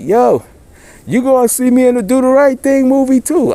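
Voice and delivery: high, loud call across the street; yelled out loud. Retold or imitated. Calling after Rosie Perez heading to her car, loud high yell. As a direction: shout it after her as she walks off. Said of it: "I yells that shit loud".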